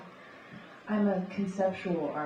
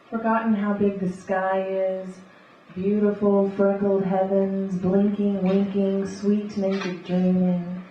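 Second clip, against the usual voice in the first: rhythmic beat poetry reading; beatnik; 9 dB louder than usual. Acted. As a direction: read it like a coffeehouse beat poet. Spoken word poetry reading.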